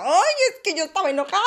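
high, loud, fast mock whine of her old angry self; hammed-up past-self complaint, laughing. Mocking her angry past self to her followers, shrill and fast. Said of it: "les decía".

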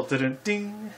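high, soft "Dun-dun-ding" rimshot; mock rimshot; imitated drum sting. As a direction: hum a comedy sting. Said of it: "imitated sting after joke".

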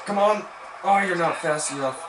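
high, loud exasperated groan; real frustration; frustrated urging. Real, not acted. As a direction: urge him on, then sag as he's caught. Runner fails to turn the corner.

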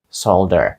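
Soldier said as "SOHL-der", meaning solder; British "SOHL-der" for Soldier, with the l sounded; British pronunciation. Shown as a demonstration. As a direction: say Soldier almost as solder is spelt, with l and d. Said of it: "Якщо вам потрібна британська".

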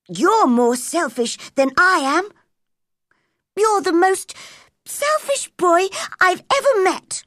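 loud, high, furious retort. A narrator's reading. Furious Mary shouts at Colin, loud and high. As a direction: hurl it back at him. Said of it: "Mary had never been so furious".

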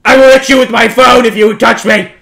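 usual speaking voice

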